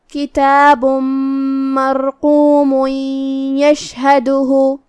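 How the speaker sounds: slow, drawn-out melodic tajweed chant; slow tajweed recitation; Quran recitation. Shown as a demonstration. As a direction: recite each word stretched and sung. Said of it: "کلمت یشہدہ میں یہاں حرف الشین ہے".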